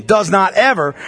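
"Does not ever." with weight on "ever" and a falling end; stress on does not ever. Shown as a demonstration. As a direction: punch the double negation. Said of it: "It's a double negation".

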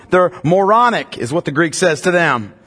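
usual speaking voice

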